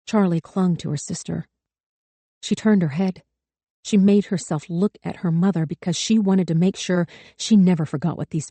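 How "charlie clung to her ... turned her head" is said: usual speaking voice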